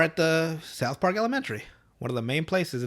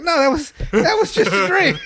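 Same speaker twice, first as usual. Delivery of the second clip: very high, loud, laughing denial; embarrassed denial; 8 dB louder than usual. Retold or imitated. Boy denying the probe, very high, loud and laughing. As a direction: deny it in a panic.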